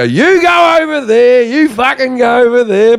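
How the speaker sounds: high, loud, slow shouted orders; commanding shout. Retold or imitated. Breaking up a fight between two women, loud commanding shout. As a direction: bark them apart like a bouncer. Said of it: "So I jump in there and I go".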